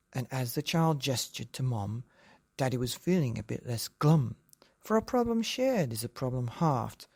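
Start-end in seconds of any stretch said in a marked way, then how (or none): none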